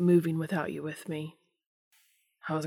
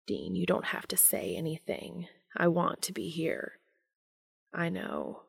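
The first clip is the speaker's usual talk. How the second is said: whisper; 6 dB quieter than usual. A narrator's reading. Castiel gently reassuring Dean.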